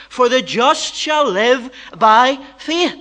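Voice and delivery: slow, weighty verse reading; slow scripture reading. Shown as a demonstration. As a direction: read the verse slowly and weighty. Quoting scripture slowly.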